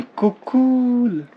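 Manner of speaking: put-on high, very slow baby-talk puppy; cute puppy voice. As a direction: coo it like a cuddly toy dog. Voicing the toy puppy.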